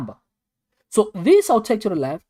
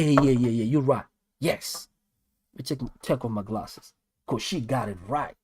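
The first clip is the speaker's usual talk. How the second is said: ordinary delivery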